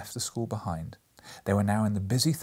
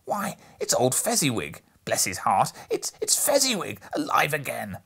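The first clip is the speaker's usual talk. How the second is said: put-on louder, delighted old man rising high; great excitement; 5 semitones higher, 5 dB louder than usual. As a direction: burst out with joyful recognition. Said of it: "cried out in great excitement".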